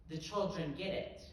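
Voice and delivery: ordinary talk, nothing marked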